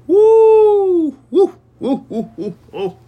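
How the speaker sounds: like a panicked siren that winds down; alarmed woo-woo siren. Pretend. Imagining Chiefs scoring, mock panic.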